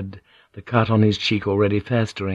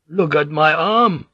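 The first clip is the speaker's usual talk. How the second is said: high, drawn-out moan; 7 semitones higher, 3 dB louder than usual. A narrator's reading. Wounded prisoner showing his festering arm, moaning. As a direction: moan it slowly in pain. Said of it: "Maetsuka moaned".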